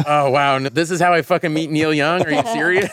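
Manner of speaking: high, loud incredulous outburst; mock disbelief. Sick performer meeting his hero at the worst moment, loud mock disbelief. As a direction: play up the exasperated disbelief.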